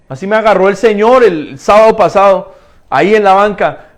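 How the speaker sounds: usual speaking voice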